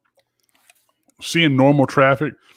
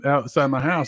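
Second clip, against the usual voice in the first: ordinary talk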